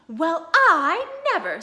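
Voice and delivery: drawn-out wonder; impressed. Acted. Wife impressed by the admiral's mention, slow wonder. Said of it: "very much impressed".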